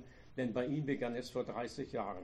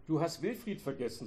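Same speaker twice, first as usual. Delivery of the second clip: plain reading voice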